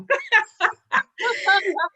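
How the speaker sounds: high laugh through tears; high, emotional laugh; teary laugh. Real, not acted. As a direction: laugh off the tears that are welling up. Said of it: "I always get emotional".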